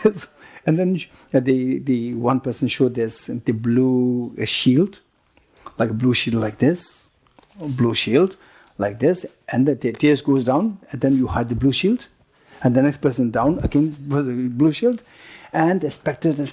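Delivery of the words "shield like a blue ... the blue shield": normal talking voice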